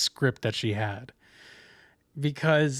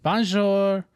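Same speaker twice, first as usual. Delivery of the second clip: higher, jokey French bonjour; mock French accent; 6 semitones higher, 3 dB louder than usual. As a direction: echo the joke in a put-on French accent. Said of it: "very thick French accent".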